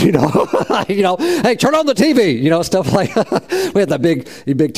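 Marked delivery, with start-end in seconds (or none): 1.2-2.3 put-on higher, breezy college kid calling out; a light imitation of a young student, pitched up; casual college kid. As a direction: toss it off like a carefree student asking for the TV. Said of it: "talk like any other young college student".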